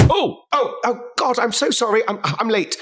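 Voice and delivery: high, stammering apology; flustered small woman. Acted. Woman bumps Henley rushing to work, flustered high voice. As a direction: apologise in a hurried, high-pitched voice. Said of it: "a small human woman rushing".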